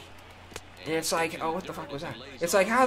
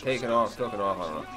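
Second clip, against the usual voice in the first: ordinary talk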